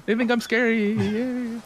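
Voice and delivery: put-on high-pitched, gleeful child voice; excited little kid. Child delighted the grown-ups are scared, high excited cheering. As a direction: cheer with drawn-out, squealed yays. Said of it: "They're excited. They go".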